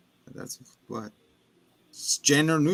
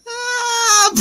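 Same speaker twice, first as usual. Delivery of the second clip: high loud retold death scream; mock scream; 8 dB louder than usual. Boy getting hit by the door, a high mock scream. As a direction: let out a short hammed-up shriek. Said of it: "you hear".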